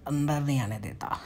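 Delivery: normal talking voice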